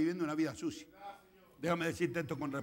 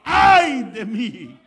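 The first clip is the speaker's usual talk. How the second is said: very loud, long-drawn cry of woe; high, hugely loud lament; anguished cry; 5 semitones higher, 19 dB louder, much slower than usual. Acted. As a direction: cry out in despair, stretching each word. Acts Isaiah crying out in woe.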